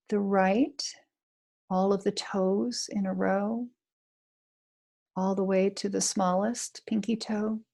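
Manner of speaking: guided meditation. Acted. Chaplain guiding listeners through a body scan, calm meditation voice. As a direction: ease the listener gently from toe to ankle.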